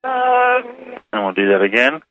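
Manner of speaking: reversed audio, warped and high; words heard as if played backwards; backwards speech. Shown as a demonstration. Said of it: "you play it backwards".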